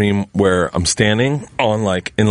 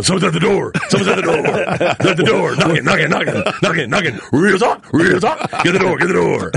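lightly higher, urgent nagging; friend Siraj; 5 semitones higher than usual. Retold or imitated. A hint of Siraj nagging about the door. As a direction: nag like a friend at your ear. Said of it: "Siraj just going".

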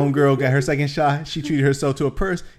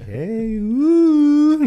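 high whooping cat call; 12 semitones higher, 3 dB louder than usual. Retold or imitated. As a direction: call out like a flirty whistle. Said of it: "kinda giving the cat call".

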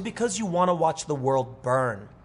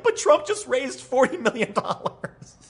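high-pitched, laughing disbelief; can't-believe-this mock outburst, cracking up; incredulous protest; 9 semitones higher, slower than usual. Acting out exasperated disbelief at colleagues.

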